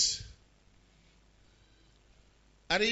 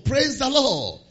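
usual speaking voice

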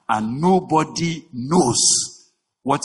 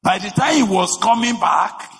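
high, loud impassioned preaching; preaching at full force; 7 semitones higher, 3 dB louder than usual. Acted. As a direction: drive the story home from the pulpit.

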